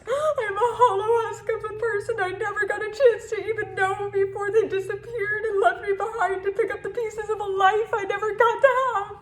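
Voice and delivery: high, fast, anguished rush; despairing outburst. Acted. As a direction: pour it out in one desperate breath. Poem erupts in despair.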